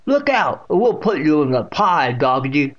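high, loud warning to the dog; exclaiming great-aunt. A narrator's reading. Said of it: "she exclaimed".

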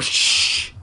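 sharp hissed hush; hissed shush. A narrator's reading. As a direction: hiss it into an ear. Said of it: "she hissed again in my ear".

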